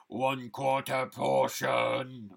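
slow, drawn-out put-on voice; a grumbling junk dealer, drawn out slowly; funny creature voice. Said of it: "see, you did it".

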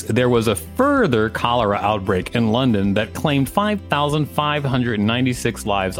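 ordinary talk, nothing marked

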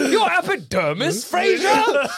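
high laughing fussy brother voice; prissy, squealing through laughs; Niles impression. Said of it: "That's the perfect Frasier line".

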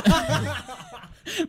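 high burst of laughter. Real, not acted. Laughing before introductions.

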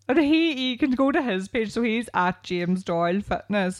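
ordinary delivery

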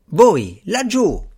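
loud, high challenging call; shout. A narrator's reading. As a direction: call out menacingly to someone over there. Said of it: "gridò Strange agitando il bastone".

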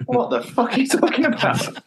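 high-pitched mock outburst of disbelief; baffled outburst. Fan retells Doctor Johnson's baffled outburst, pitched high. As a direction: squeak out a baffled swear as Johnson. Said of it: "And he's like".

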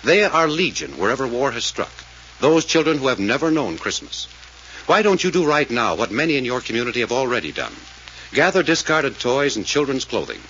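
earnest announcer appeal, measured; radio appeal read. Acted. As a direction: plead with listeners like an old radio announcer. Sponsor appeal before the show.

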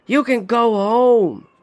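high, slow, tender plea; gentle urging. A narrator's reading. Father gently sending his son home, slow plea.

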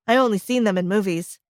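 plain reading voice

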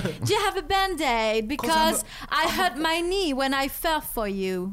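slow, put-on British accent pickup line. Shown as a demonstration. Retelling the band-aid pickup line in a hammed-up British accent. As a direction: deliver the cheesy line in a mock posh British accent. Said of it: "Est-ce que quelqu'un sait faire l'accent, euh, british?".

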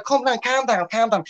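high mock Scouse "calm down"; a hammed-up Liverpudlian telling everyone to calm down; Scouse catchphrase. Mock pundit calming his co-host, in high Scouse. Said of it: "drop the Scouse accent".

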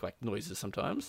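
ordinary talk

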